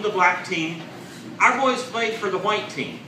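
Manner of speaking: ordinary talk, nothing marked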